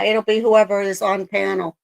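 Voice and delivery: usual speaking voice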